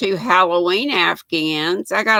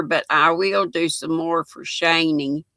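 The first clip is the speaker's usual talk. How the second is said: ordinary talk, nothing marked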